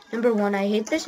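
normal talking voice

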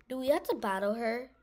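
usual speaking voice

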